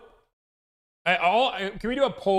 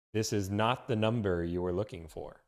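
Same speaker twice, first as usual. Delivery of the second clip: lower, quieter, steady mind trick; low calm Jedi; 8 semitones lower, 7 dB quieter than usual. Retold or imitated. Reading the Star Wars quote, low calm Jedi. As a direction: soothe them into believing it.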